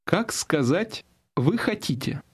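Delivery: usual speaking voice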